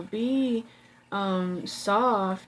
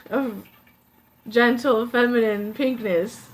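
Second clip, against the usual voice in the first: laughing; 3 semitones higher, 8 dB louder than usual. Real, not acted. Laughs at own flowery description.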